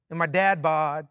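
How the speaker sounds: ordinary talk, nothing marked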